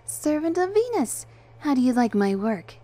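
high, loud cheery angel; cheerful greeting. A narrator's reading. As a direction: introduce yourself brightly and proudly. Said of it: "the angel greeted us cheerfully".

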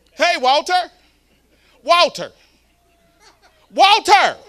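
high, loud mocking call of Walter; screaming a name. Someone taunting him, shouting Walter loudly. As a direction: yell the name across at him. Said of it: "You can scream Walter at me".

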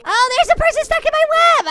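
high, loud gleeful cry; excited discovery. Pretend. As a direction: pounce on the find, thrilled. Spots prey in the web.

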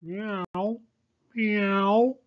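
high meowing cry; cat meow. Acted. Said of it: "cried the cat".

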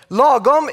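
Lagom said slowly, LAH-gom with Swedish vowels; the native Swedish Lagom, first syllable high. Shown as a demonstration. Teaching viewers a Swedish word, Swedish lagom pronounced. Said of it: "it's called lagom".

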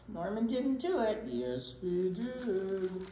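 ordinary delivery, nothing marked

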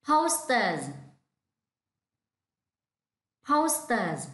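Posters with a clear final z sound, said slowly; model pronunciation. Shown as a demonstration. Teacher modelling Posters for the class to repeat. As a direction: say Posters carefully, ending on the z. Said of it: "repeat after me".

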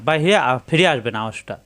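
ordinary delivery

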